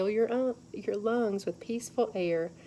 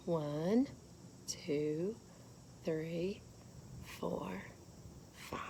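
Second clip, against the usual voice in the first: soft counting; 2 semitones lower, 10 dB quieter, much slower than usual. Shown as a demonstration. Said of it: "soft counting demo".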